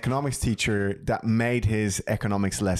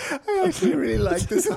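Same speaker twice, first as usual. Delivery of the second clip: high, giggly praise; laughing delight; 3 dB louder than usual. Real, not acted. Praising the friend's joke, laughing high. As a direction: gushes through laughter.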